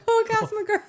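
laughing through a name. Real, not acted. Joking about baby names.

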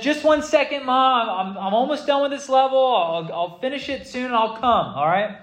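put-on high, whiny teenage stall; kid stalling mom. Son stalling mom while gaming.

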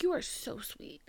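soft retelling of kind words; hushed, imitated reassurance; tender quote. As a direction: drop the volume for a gentle compliment.

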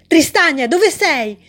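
loud desperate calling out; shouting. A narrator's reading. Alice calling out for Tristania, loud shout. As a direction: yell for her lost friend. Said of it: "gridò ancora grondante".

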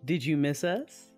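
normal talking voice

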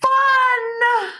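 high excited yell; excited shout. Real, not acted. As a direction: shout it with glee. Enthusiastic final answer.

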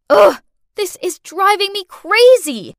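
loud, high-pitched frustration; groan, then vent loudly; exasperated outburst. A narrator's reading. Narrator exasperated by the child.

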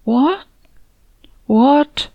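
choked. Acted. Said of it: "He attempted to clear his throat".

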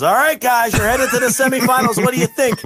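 high, bright mock interviewer voice; announcer voice. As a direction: play the ring announcer hyping them. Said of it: "He goes".

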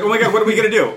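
high, loud hammed-up panic; mock panic. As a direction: squeal it like a helpless victim. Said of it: "the question was like".

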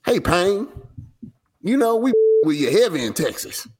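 high, put-on Texas accent. Voicing Dallas fans answering the rapper, put-on Texas accent. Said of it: "He did it in a Texas accent".

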